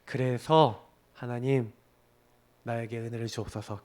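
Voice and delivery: quiet surrendering prayer; humble prayer. Shown as a demonstration. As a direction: pray it gently and humbly. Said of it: "이렇게 하세요".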